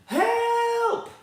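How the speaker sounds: high, panicked cry; mock panic at the runaway pram; help. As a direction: scream for help. Runaway pram heading for pond.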